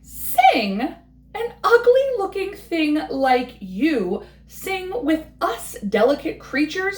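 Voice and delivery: drip with snobbish scorn; sneering head bird. Acted.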